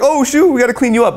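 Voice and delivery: put-on high doting parent fussing over a messy baby; parent cooing at a baby. Parents to a baby who soiled himself, high cooing. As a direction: coo at the baby, high and fond. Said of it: "people are like".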